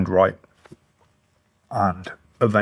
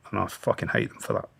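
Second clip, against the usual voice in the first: bitter whisper; 9 dB quieter than usual. Real, not acted. Speaking of those who failed him, a bitter whisper. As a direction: let it drop to a near whisper.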